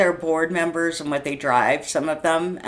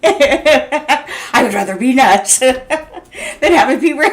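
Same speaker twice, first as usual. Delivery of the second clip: laughing; 6 semitones higher, 6 dB louder than usual. Real, not acted. Venting about the news, laughing helplessly. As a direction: laugh it out, half in despair.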